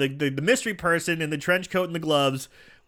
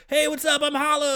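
high-pitched comic taking the stage; hyped-up stage greeting; 12 semitones higher than usual. Retold or imitated. Comedian to a warm crowd, hyped greeting.

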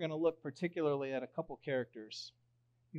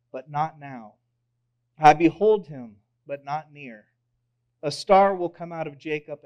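solemn scripture reading; 12 dB louder than usual. Acted. Pastor reads Balaam's oracle to his congregation, solemn and proclaiming. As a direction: read it like a prophet's oracle.

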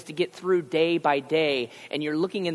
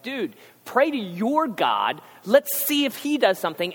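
mocking sailor demand; 8 semitones higher, 5 dB louder than usual. Sailors on the storm-tossed ship demanding Jonah pray, loud and mocking. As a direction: bark it like an impatient deckhand.

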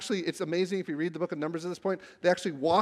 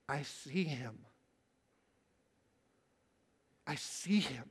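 hushed awe; 4 semitones lower, 10 dB quieter, slower than usual. Acted. Awed recitation of vision.

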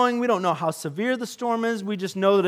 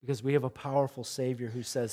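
ordinary talk

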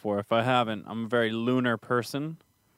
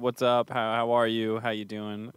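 normal talking voice

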